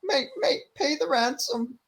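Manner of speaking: whiny, very high Scouse begging; Darren Till. Acted. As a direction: beg in a squeaky, badly faked Scouse accent. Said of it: "imagine Darren Till going like".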